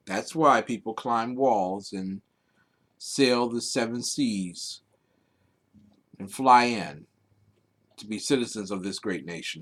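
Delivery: low, soft patriotic address; solemn speech. Acted. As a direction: deliver it hushed and reverent.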